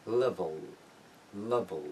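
"level" with a dark, lifeless last L; deadened final L. Shown as a demonstration. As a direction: let the final L of "level" go dull.

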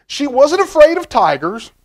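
lifted, sing-song read-aloud; storybook reading. A narrator's reading. Reading the picture book to children, lilting storybook voice. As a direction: read it bright for little listeners.

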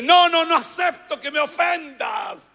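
high-pitched indignant protest; hammed-up outrage, pitched high; mock indignation. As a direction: play the outraged, offended liar. Acted indignant denial by liar.

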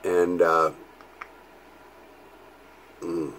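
talking with clenched pipe; muffled lips around stem; pipe in mouth. Real, not acted. Seen 0: pipe stem held between lips throughout labelled words.

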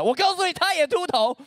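emphatic punchline. Acted. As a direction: land the joke on the bald photo. Punchline on bald photos.

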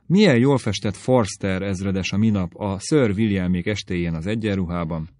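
ordinary delivery, nothing marked

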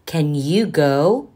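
lively, sing-song picture book reading; animated storytime voice for young listeners; reading to children. Acted. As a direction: read it out like storytime for little kids.

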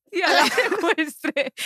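risa entre lágrimas de vergüenza; riendo. Real, not acted. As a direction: confiesa el secuestro riéndose. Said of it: "laugh cry". (confesión entre risas)

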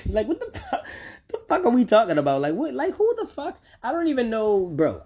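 squeaky high disbelieving laugh-talk; incredulous outburst. Real, not acted. Ranting to viewers, high-pitched stunned disbelief. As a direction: pitch jumps way up in outraged disbelief.

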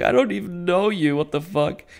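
high-pitched bewilderment without shouting; mock surprise. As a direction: squeak it out in disbelief, easing down at the end. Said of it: "he's really surprised what's happening".

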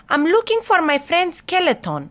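lightly voiced high-pitched goblin; high, searching goblin line; goblin character.

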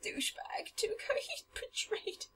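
soft whisper with a half sob; whispered mock lament. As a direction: whisper as if betrayed.

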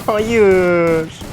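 high, loud wail of defeat; despairing wail. Real, not acted. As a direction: cry out as the game is lost. Dies again, despairing cry.

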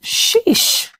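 high breathy whispered exclamation; whispered sheesh. Real, not acted. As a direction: breathe it out, impressed and a little awed. Reacting to stormy waves.

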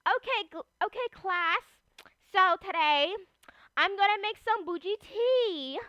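playful instructor showing how it's done; play cooking teacher. Pretend. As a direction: address a pretend class with lifted, sing-song phrases. Plays a teacher addressing a class.

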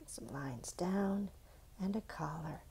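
soft whispered wrap-up. Acted. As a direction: hush it out like a secret. Finishing recap quietly.

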